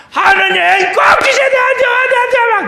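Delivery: high, loud shouting plea; imitating a frantic believer shouting a loud, high prayer; desperate crying-out prayer. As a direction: scream the demand at God, refusing no. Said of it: "막, 막, 막 부르짖어".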